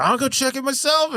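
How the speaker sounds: high, put-on helpful voice; squeaky, hammed-up excuse; mock eager lie. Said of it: "And then he's like".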